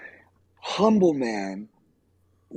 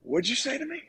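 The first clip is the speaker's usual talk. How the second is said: high, incredulous question; retold challenge, pitched way up; challenging comeback; 12 semitones higher, 3 dB quieter, faster than usual. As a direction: ask it as if daring them to repeat it. Acted challenge to the insulting kid.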